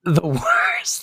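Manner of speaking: high breathy whisper; hushed, high whisper of disgust; whispered punchline. Acted. Punchline about the Sylvia payoff.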